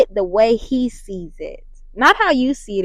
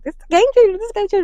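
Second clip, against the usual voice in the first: high, hammed-up copy of her own gushing; mocking her own hype; 10 semitones higher, much faster than usual. Teasing herself for repeating game changer, mock hype. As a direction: poke fun at her own catchphrase. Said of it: "I know I'm just saying after every one".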